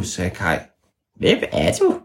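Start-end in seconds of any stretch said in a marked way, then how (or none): 1.2-2.0 very high squeaky creature voice; tiny high creature voice; ævle bævle voice. Acted. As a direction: squeak back like a cheeky hidden creature. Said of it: "sagde ævle bævle stemmen".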